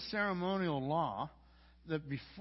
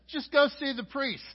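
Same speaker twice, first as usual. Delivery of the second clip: raised, higher Jesus line; Jesus telling them to go; 8 semitones higher, 7 dB louder than usual. Acted. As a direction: call it out high and louder. Jesus voiced in the story.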